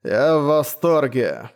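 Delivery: slow, lisping delight; slushy lisp. Acted. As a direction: lisp it, savouring each word. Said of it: "пришепетывал рыцарь".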